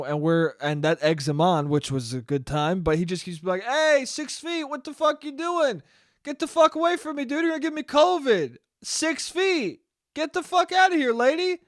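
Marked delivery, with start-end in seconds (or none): 3.6-11.6 high-pitched hammed-up yell; mock yelling. Dealer mockingly yells six feet at the girl, high mock yell. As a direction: throw it back at her, pitched way up.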